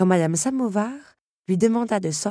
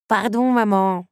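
embarrassed apology; 6 semitones higher, 7 dB louder, slower than usual. Acted. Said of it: "rougit l'enfant".

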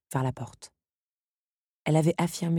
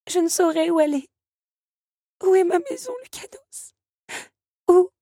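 put-on higher, pleading young woman near tears; Belle tearful; 13 semitones higher, 4 dB louder than usual. A narrator's reading. Belle in tears asks Lucanus where her home is, pleading. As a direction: ask where home is, lost and weeping.

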